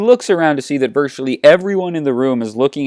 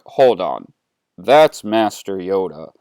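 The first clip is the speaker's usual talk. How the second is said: low, slow, weighty voice; ominous villain realizing something, pitched down; Vader; 5 semitones lower than usual. Acted. Vader senses Yoda on the shuttle, low and deliberate. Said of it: "And Vader is, like, totally sensing Yoda".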